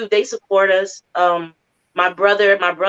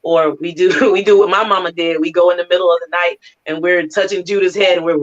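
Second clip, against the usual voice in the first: normal talking voice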